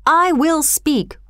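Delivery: high, slow, drawn-out insistent yell; narrated defiant yell. A narrator's reading. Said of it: "yelled the man".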